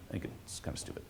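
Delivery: whispering under his breath; a quick whispered aside. Real, not acted.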